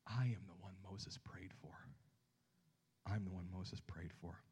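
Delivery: soft whispered declaration; Jesus whispering his claim; hushed Jesus. Pretend. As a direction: breathe it like a secret revelation.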